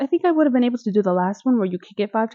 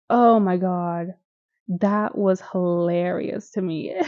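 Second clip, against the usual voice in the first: normal talking voice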